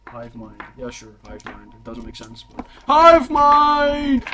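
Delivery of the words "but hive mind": high, loud, drawn-out name call; arena announcer. Acted. Game master announcing a fighter's name, booming announcer. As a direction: stretch it out for the crowd. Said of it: "this announcement, by the way".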